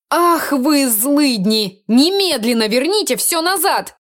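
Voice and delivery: high, slow, outraged cry; furious outburst. Acted. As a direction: hurl it at them in outrage. Mina furious at the swap.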